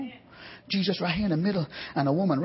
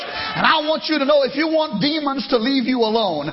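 higher, louder shouted preaching climax; climactic preaching; 7 semitones higher, 6 dB louder than usual. Acted. Preacher urging congregation to press in, loud high shouted climax. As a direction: hit the peak and hold it.

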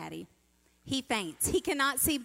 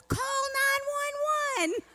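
high, drawn-out panicked holler, imitated; mock scream; 4 dB louder, slower than usual. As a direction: imitate a frantic dad crying out to the whole world. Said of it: "is screaming to the world".

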